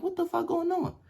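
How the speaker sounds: high-pitched baffled question; retold bewilderment. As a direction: act out waking to a shocking sight. Retold shock at waking.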